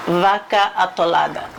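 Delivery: ordinary delivery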